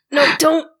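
ordinary talk, nothing marked

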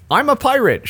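high-pitched headline quote of a shouted claim; playful imitation of a shout, not full volume; mild mock shout. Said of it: "shouted drunken woman before hijacking".

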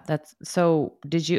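normal talking voice

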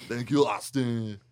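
loud, put-on foreign accent; Arnold Schwarzenegger. Comic plugging a pizza place, mock Arnold accent. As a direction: announce it in a hammed-up Arnold accent. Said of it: "Like Arnold Schwarzenegger".

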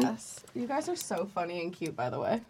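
barely audible murmur; very quiet. Real, not acted. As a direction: say it almost under your breath. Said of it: "Louder for the people at the back".

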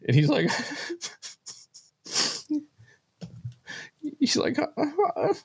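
high, breathy imitation of dad sobbing; imitated choked sobs. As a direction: mimic the father choking up with tears.

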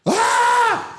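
high hammed-up child's cry; mock kid wail. Retelling the Australian kid crying on the flight, high mock wail. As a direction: wail like a bawling little kid. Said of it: "it's real cry".

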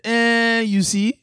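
drawn-out Yoruba accent. Shown as a demonstration. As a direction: demonstrate a slow Yoruba man's accent. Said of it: "mine is a Yoruba man".

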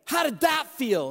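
coach's excited holler, re-enacted; retold yell. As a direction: call out to a player mid-game. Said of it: "I yelled out his name".

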